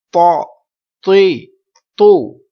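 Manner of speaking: heavy emphatic Arabic t in تو، تی، تو, loud; full, thick letter. Shown as a demonstration. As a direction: make تو، تی، تو thick and full-mouthed, each falling. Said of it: "اب ہم آواز کو پر کریں گے، موٹا کریں گے".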